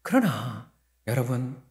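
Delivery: normal talking voice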